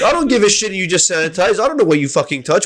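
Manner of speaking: heated rant. Retold or imitated. Retelling his own rant.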